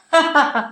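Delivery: high mocking laugh burst; villain's burst of laughter. A narrator's reading. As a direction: laugh out at him, menacing. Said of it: "അപരിചിതൻ പൊട്ടിച്ചിരിച്ചു".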